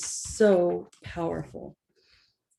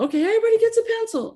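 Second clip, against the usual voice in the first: much higher, fast, breezy announcement; mock teacher announcement; 5 dB louder, much faster than usual. Teacher handing out pencils to all, fast mocking voice. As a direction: rattle it off like a careless teacher. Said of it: "So it's like".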